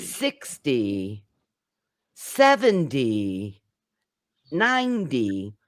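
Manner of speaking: SIX-ty, SEV-enty, NINE-ty, the ending said as dee; teacher's measured demo, hitting SIX, SEV, NINE; stress on first syllable. Shown as a demonstration. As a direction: punch the first syllable of sixty, seventy, ninety. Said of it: "stress the first syllable".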